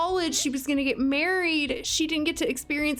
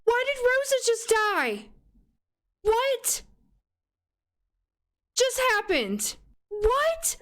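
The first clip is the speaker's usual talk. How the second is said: high, stunned disbelief; real shock; 10 semitones higher than usual. Real, not acted. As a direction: cry out in sudden disbelief. Sim daughter suddenly dies in game.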